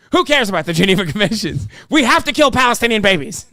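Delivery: mock outraged yell, fast then loud; angry yelling. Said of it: "You're just angrily yelling at".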